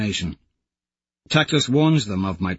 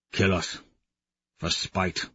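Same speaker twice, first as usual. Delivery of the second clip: low, much softer answer; grim whisper back; old warrior whispering grimly; hushed reply; 3 semitones lower, 6 dB quieter than usual. Acted.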